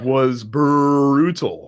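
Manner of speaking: "brutal" stretched long on a rolled-out start; drawn-out emphasis. Real, not acted. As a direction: drag out the first syllable of "brutal". Stretched word describing the beatdown.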